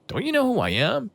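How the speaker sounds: mock the self-important jerk, pitch way up; imitating a pissy rich guy; pissy entitled. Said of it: "he gets all pissy".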